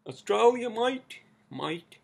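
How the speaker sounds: attempted Australian accent, high and stretched; hammed-up Australian "mate" with a rising end. Shown as a demonstration. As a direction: have a go at loud, drawn-out Aussie. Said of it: "Is that how you say it in Australian accent?".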